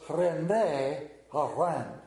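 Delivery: slow, drawn-out French-accented René Arend. Shown as a demonstration. Preacher testing his French on the chef's name, slow French accent. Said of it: "does that got a French accent to it?".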